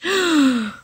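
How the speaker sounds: sharp intake of breath; quick gasp; startled gasp. Acted. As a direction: gasp at the itch. Itch reaction.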